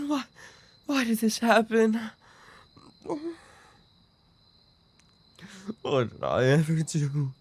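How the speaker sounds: high, broken and tearful; despairing sobbing. Acted. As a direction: plead with yourself through tears. Despairing self-blame after loss.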